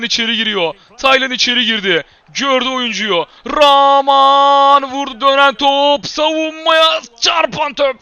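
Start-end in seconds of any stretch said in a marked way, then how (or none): 3.5-8.0 higher, louder play-by-play shout; rising excited match call; 1 excited commentary. Real, not acted.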